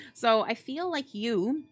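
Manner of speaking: normal talking voice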